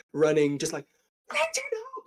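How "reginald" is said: very high, frantic gnome shout; gnome yelling. Acted. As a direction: scream the name across the street. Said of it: "lungs yelling for whoever the Reginald is".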